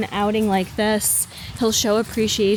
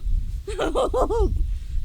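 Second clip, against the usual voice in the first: high laugh while straining; breathless laugh; 11 semitones higher than usual. Real, not acted. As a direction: laugh through the effort. Laughing during push-ups.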